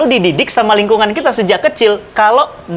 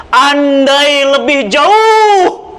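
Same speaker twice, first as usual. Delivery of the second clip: dying cry; 4 semitones higher, much slower than usual. Acted. Dying man cries out his regret, very slow and stretched. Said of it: "Sya'ban teriak".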